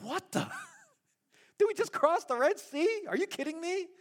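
can't believe what he's hearing; Moses, stunned at the crowd's complaint; incredulous Moses. Retold or imitated. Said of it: "Moses is like".

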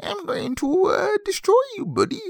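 squeaky high little creature; leprechaun voice. Acted. Leprechaun threatens the neighbor, high squeaky voice. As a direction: threaten in a tiny high-pitched goblin voice. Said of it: "what my leprechaun voice was before".

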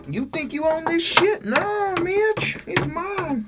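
squeaky high falsetto scolding; hammed-up bossy dog talking back in falsetto; Marley. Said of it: "That's what Marley says to me".

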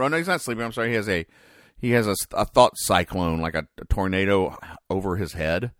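normal talking voice

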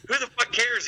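high-pitched, dismissive retort; imitated don't-care comeback; dismissive wife. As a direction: toss it off high, as the unbothered wife. Wife's dismissive retort, retold.

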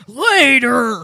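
loud, hammed-up holler; comic shouted outburst; mock yell. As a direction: blast the word out, joking. Hammed-up yelled line from the show.

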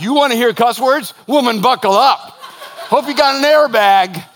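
playful loud warning. Retold or imitated. Pastor retelling a joke to his wife, laughing loud warning. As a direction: hype it up like a laughing threat. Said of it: "And I'm like".

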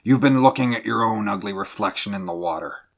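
put-on contemptuous old skipper's sneer. A narrator's reading. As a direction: pour ridicule on them. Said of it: "sneered the old man".

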